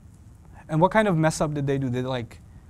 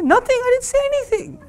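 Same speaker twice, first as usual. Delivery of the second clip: much higher, mock-innocent teen voice; innocent kid denial; 4 dB louder than usual. Teen denying to his mother, high and innocent. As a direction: play dumb like a caught kid.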